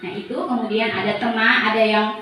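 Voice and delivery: normal talking voice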